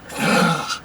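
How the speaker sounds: sharp retold gasp; acted gasp. Re-enacting herself with friends and family, mock gasp. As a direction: gasp as if struck by the idea. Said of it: "I would be like".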